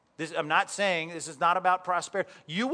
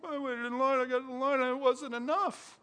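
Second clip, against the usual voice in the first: put-on much higher, small child voice; sad little boy; 8 semitones higher than usual. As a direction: whimper it like a disappointed kid. Tearful son reports failure.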